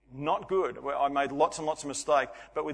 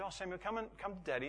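put-on coaxing a child softly back from a cliff edge; softer, calm and gentle, no panic showing; father quietly coaxing his son away from danger, calm; 3 semitones higher, 9 dB quieter, faster than usual. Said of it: "And so very calm".

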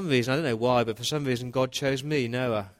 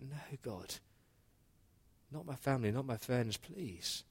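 much softer, near breaking, begging; soft desperate plea; 2 semitones higher, 10 dB quieter than usual. Retold or imitated. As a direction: plead under your breath with God. Noah pleads to spare family.

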